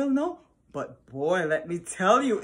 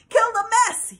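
much higher, louder battle cry; yelled; 14 semitones higher, 10 dB louder than usual. Acted. Cats charging at the snow with a loud yell. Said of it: "yelled the cats".

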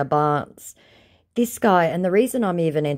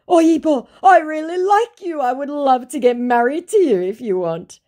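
high, pleading mock voice of the scammer; 13 semitones higher, 7 dB louder than usual. Reading the scammer's message aloud in a high mock voice. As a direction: play the whiny scammer, pitched up.

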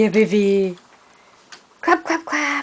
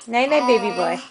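sleepy talk to pet; 4 semitones lower, 4 dB quieter than usual. Real, not acted. Bedtime goodnight to baby.

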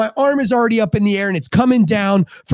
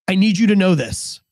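ordinary talk

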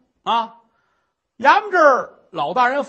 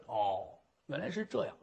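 dry low aside; 11 semitones lower, 15 dB quieter than usual. Acted. Official feigns belief.

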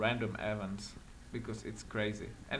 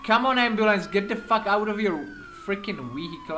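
raised, louder, exasperated call; impatient yell; 7 semitones higher, 7 dB louder than usual. Acted. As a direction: hurry them up with a frustrated shout. Yelling impatiently at the ambulance.

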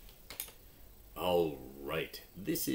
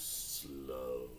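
said slowly; 3 semitones lower, 5 dB quieter than usual. Shown as a demonstration. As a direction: stretch the word slow out long. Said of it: "I want you to listen how my voice goes".

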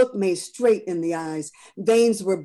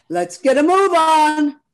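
a gruff railroad man, much higher and louder; gruff conductor; 10 semitones higher, 11 dB louder than usual. Acted. Conductor rushing the family onto the car, gruff and loud. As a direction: bark the order to hurry up. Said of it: "The gruff voice behind them".

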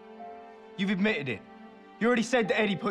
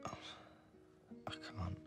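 whispering; 7 semitones lower, 19 dB quieter than usual. Acted. Tearful refusal to leave.

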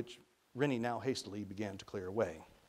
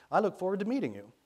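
louder, higher intercom greeting; voice through speaker; 8 semitones higher, 7 dB louder, faster than usual. A narrator's reading. Young man greeting the boy by intercom, projecting. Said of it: "the voice through the speaker".

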